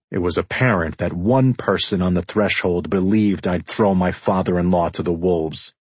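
plain reading voice

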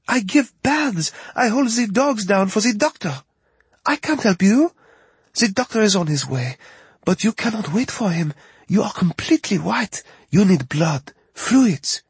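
put-on high young woman with French accent; worried Parisian woman, French accent. French vet assistant voiced.

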